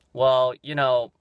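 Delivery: mock a snippy clerk, slow and loud; drawn-out, pointed retort with attitude; imitating someone snapping back slowly; snappy attitude. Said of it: "she just kinda snaps".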